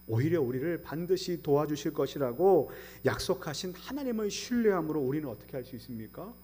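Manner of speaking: usual speaking voice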